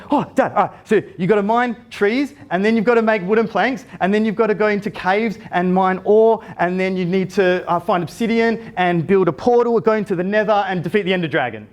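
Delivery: high, eager rush of instructions; a boy gushing about his favourite game; super excited kid. Retold or imitated. Son explaining Minecraft to dad, high and eager. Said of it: "Oh, he got super excited".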